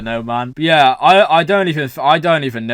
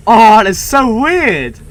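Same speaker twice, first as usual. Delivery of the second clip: slow, high, loud exclamation; weirded-out amazement; 6 semitones higher, 8 dB louder, much slower than usual. Real, not acted. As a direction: stretch it out in astonishment. Reacting to the clip.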